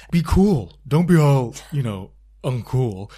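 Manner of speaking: loud, hammed-up mimicry of a housewife; terrible impression. Shown as a demonstration. Podcaster attempts the housewife's catchphrase, terrible impression. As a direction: badly mimic her, punched out loud and dragging at the end. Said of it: "I'll do the impression".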